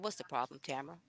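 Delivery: low, hushed soothing voice; a low, soothing imitation of John; soft soothing quote. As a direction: say it gently to calm her. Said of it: "He didn't say it in my accent".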